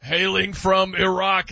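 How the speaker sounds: slow, drawn-out mock ring intro; ring announcer. Host mimicking a pro wrestling ring intro, slow and hammed-up. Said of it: "the guy comes out and he's like".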